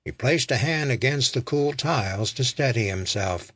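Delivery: ordinary delivery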